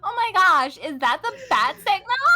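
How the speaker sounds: put-on loud, high, gushing Valley Girl superhero; Valley Girl Batman. Shown as a demonstration. Valley Girl superhero spotting the bat signal, gushing. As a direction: gasp it like a thrilled Valley Girl. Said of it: "Show me Valley Girl Batman".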